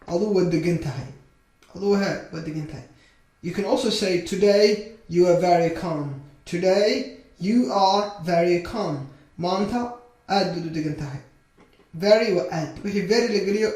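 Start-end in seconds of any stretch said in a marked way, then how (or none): 4.2-9.1 slow, measured model sentence; slow modelling. Shown as a demonstration. Teacher gives learners another sentence to copy, slowly. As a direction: space the words out for learners.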